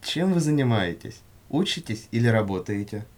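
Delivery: usual speaking voice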